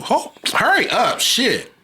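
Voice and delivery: high, snappy mock mother; impatient mother. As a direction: order him to hurry, annoyed. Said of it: "I got shit to do".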